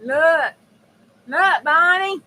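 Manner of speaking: high, loud, insistent look; excited calling out. Real, not acted. Streamer showing Bonnie the card, excited call. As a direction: call out loudly for attention.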